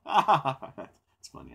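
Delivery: mock amused reply, laughing through it. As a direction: imitate them brushing it off with a laugh. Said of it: "They're always like".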